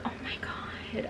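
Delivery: hushed, breathy gasp of shock; whispered shock. Retold or imitated. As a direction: whisper it like dreadful news. Friend reacting to bad news.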